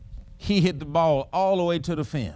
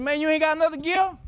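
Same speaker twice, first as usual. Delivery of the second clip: teasing; 8 semitones higher, faster than usual. Retold or imitated. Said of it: "I said".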